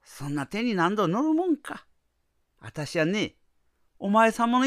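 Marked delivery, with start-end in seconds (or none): none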